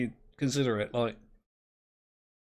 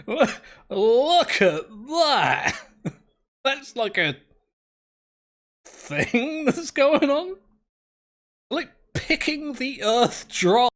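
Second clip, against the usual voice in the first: laughing amazement; 9 semitones higher, 5 dB louder, slower than usual. Real, not acted.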